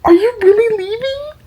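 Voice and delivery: high mocking disbelieving voice; mock disbelief. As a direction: parody the coworker's shock up high. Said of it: "And they're like".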